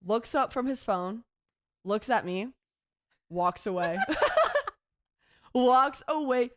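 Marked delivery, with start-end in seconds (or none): 4.0-4.7 high burst of laughter; shrieking laugh. Real, not acted. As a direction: crack up at the punchline. Laughing at him walking away.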